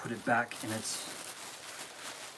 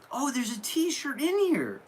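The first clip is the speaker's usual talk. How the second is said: surprised discovery; 11 semitones higher than usual. Real, not acted. Discovering the shirt in the box.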